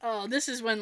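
ordinary delivery

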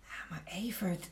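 breathless whisper of shock; hushed whisper. Acted. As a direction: whisper it, stunned by what she sees. Shocked at a found photo.

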